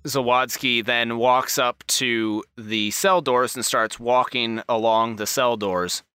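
normal talking voice

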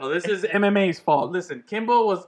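ordinary talk

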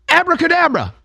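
"Abracadabra" high and confident with a falling close; mock spell cast. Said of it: "she says it the third time".

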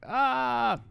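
high-pitched "ah" of distress; short imitated wail after a fall; mock cry. Acts out cry after falling downstairs.

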